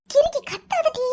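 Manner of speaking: high urgent hush at her sister; sharp hushing snap. Acted. Sister hushing her sibling, a high sharp snap. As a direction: hiss it high and tight to shut her up.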